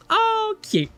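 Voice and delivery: high squeal over something cute; high cutesy gush; cooing delight. Real, not acted. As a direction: coo at it like a puppy. Finds a tattoo cute.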